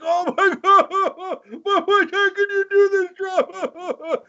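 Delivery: very high, slow, put-on wail; mock wailing maga. Maga fans wailing at Trump in a high put-on voice. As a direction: wail like a betrayed fan, drawn out. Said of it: "And they're like".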